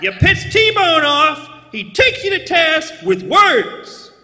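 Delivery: boastful high, loud, sing-song patter; high loud bragging. Acted. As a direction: brag it in a loud, singing boast.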